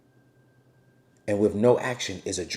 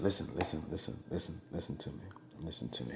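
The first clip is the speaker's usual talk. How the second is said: whisper; 8 semitones lower, 11 dB quieter, faster than usual. Real, not acted. Whispering while filming outside.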